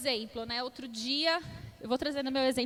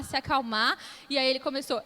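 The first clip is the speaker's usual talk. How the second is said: usual speaking voice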